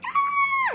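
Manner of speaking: very high squealing scream; excited scream. Retold or imitated. Friend hears they're going to Vegas, squealing scream. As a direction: squeal with joy.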